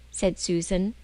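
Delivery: ordinary delivery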